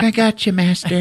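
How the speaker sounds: high, sweet little droid voice; cute robot voice. Retold or imitated. BB-8 reassures Finn, high little droid voice. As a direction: chirp it like a loyal droid. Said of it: "He's like".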